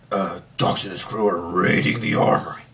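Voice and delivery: low, rough gravelly impression; gravelly hunter voice. Host mimicking a TVA hunter from the show, gravelly voice. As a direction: growl it out like a gruff hunter. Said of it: "gravelly voice".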